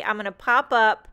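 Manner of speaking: normal talking voice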